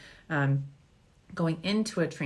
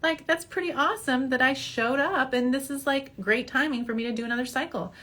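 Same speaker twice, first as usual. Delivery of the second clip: much higher, delighted amazement; amazed; 8 semitones higher than usual. Pretend. Patient seeing good ovaries, thrilled and amazed. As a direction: gasp at the good news. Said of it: "then you'll be like".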